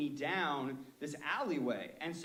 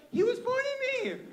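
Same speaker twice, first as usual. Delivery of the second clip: squeaky, much higher panicked excuse; scared high voice; 8 dB louder than usual. Retold or imitated. The driver blaming the guide to the store owner, squeaky high. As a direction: voice jumps way up in fright. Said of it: "your voice goes high when you're really scared".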